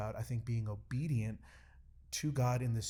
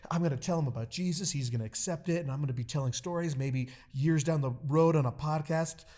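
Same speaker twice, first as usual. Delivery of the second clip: higher, louder, eager rush of plans; retold excitement; 5 semitones higher, 7 dB louder than usual. Imagining telling him about Jesus, excitedly. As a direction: get carried away acting out your hopes. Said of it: "I'm getting all excited".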